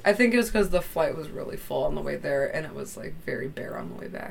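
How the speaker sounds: ordinary talk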